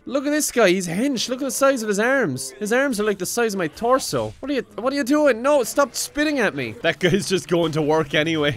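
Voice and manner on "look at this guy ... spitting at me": rising excited disbelief; excited amazement. Real, not acted. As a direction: marvel at the huge guy. Excited reaction to big man.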